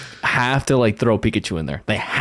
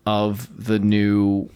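usual speaking voice

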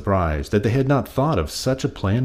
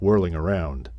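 ordinary delivery, nothing marked

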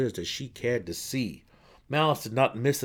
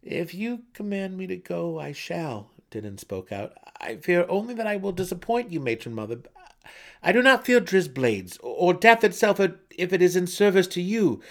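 higher, tense pleading son; anxious young son; 9 semitones higher, 8 dB louder than usual. A narrator's reading. Son pleading with his matron mother. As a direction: plead your case desperately. Denon's vow to Malice.

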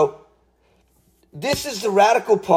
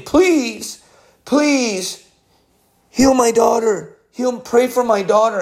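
pleading in tears; 7 semitones higher than usual. Retold or imitated. Woman begging in tears.

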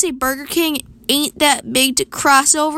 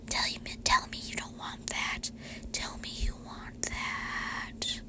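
slow tempting whisper; 19 dB quieter, slower than usual. Pretend. Tempting whisper about milkshake.

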